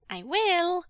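high, loud sung-out promise; singsong child. A narrator's reading. Son promises father to be good, singsong. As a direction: sing it out like a little boy. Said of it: "Jingyi sang".

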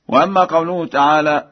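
ordinary talk, nothing marked

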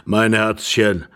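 put-on slow, drawn-out old husband's question; ponderous elderly man, taking his time; sluggish old man. A narrator's reading. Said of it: "fragte wieder der Greis in seiner trägen Langsamkeit".

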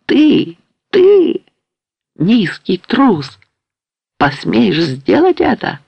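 high, outraged cry that slows into menace; wild shout. A narrator's reading. Patient finds no sabre and turns on them, wild shout. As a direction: start very high, then draw out the insult. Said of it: "закричал диким голосом".

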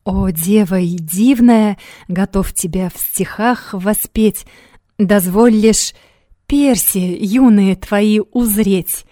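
slow, stumbling reading of verse; slow halting recitation. Acted. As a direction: read the poem haltingly, slowly. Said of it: "запинаясь, прочитала".

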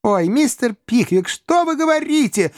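put-on two frightened girls crying out; young ladies exclaiming. A narrator's reading. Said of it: "в один голос воскликнули Эмили и Арабелла". (испуганные девичьи голоса, намного выше)

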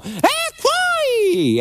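high, very slow call from afar; distant cry from above. Retold or imitated. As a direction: cry out from a balcony, high and drawn out. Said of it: "un grido piove dal cielo".

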